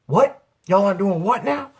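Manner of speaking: loud, high grandma demanding an answer; indignant grandma. Retold or imitated. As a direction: imitate a grandma catching the boys. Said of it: "his grandma walked in the front door".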